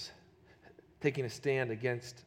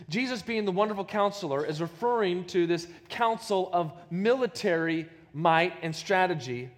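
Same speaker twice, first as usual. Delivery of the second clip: usual speaking voice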